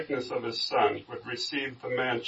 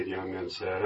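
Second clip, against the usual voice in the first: usual speaking voice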